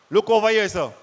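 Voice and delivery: ordinary talk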